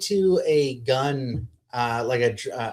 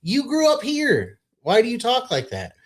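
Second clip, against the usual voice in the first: mock disbelief; 12 semitones higher than usual. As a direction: drag it out, baffled and scornful. Continues the mocking quote.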